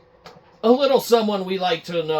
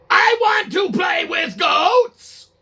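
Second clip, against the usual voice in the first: much higher, slow whiny shout; childish demand; 14 semitones higher, 7 dB louder, slower than usual. Acted. Phil Spencer demanding goats in his office, high slow shout. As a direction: shout it like a spoiled kid. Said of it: "We're gonna do a little reenactment".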